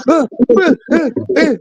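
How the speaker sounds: very high, panicked gasping; mock panic gasps. Mimicking the communist on meeting the cousin, mock panic. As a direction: imitate someone gasping in a panic attack. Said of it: "He had a panic attack".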